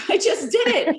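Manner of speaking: laughing high as she repeats it. Real, not acted. Proudly repeating to the host, with a real laugh. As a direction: laugh the words out in real delight.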